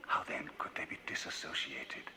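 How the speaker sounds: hushed, secretive whisper. A narrator's reading. Jekyll whispers his forbidden question to himself. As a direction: breathe the question like a dark secret.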